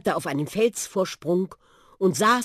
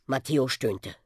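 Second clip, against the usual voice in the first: ordinary delivery, nothing marked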